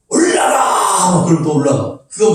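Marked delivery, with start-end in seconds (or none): 0.1-1.1 long-drawn high shout, imitated; revival preacher's cry. Revivalist at the other end of the platform, drawn-out high cry. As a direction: stretch it out like a revivalist's call. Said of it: "또 여기 가가지고 막".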